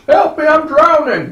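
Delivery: mock panicked cry. Acted film scene, pleading.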